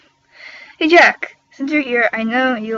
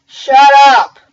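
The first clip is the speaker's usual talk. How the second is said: shouting; 4 semitones higher, 12 dB louder than usual. Real, not acted. Scolding own fangirling.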